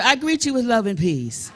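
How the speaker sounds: loud, ringing call to the room; call for the crowd to repeat. Shown as a demonstration. Singer leading the audience greeting, called out loud. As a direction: lead the crowd line by line, calling out. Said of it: "Say to your neighbor".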